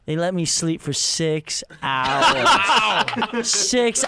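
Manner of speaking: normal talking voice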